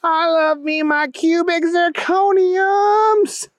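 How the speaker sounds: high-pitched, drawn-out whoop; excited yell. A narrator's reading. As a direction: yell your delight, stretching every word. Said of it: "Keith yelled".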